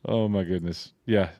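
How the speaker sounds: ordinary talk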